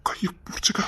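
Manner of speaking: very soft, breath-only whisper. Acted. Telling the other to hold hands, whispered. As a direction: whisper it privately. Secretly offering a hand.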